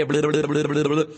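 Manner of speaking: rolling blu-blu-blu at the same intensity; echoed baby sounds. Shown as a demonstration. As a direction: copy the baby's blubbering back. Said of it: "usted póngale enfrente de él y hágale".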